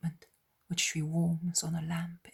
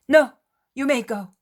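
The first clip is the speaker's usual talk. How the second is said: high, loud reassurance; raised reply; 8 semitones higher, 13 dB louder than usual. Acted. Syntyche calling to Peter over the storm, loud. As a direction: call back loudly that he may go.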